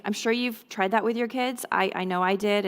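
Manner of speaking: ordinary talk, nothing marked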